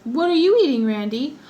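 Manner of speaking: loud call to Randy. Real, not acted. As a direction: shout the question to someone in another room. Calling out to Randy off-mic.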